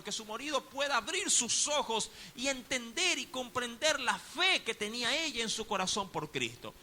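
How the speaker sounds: usual speaking voice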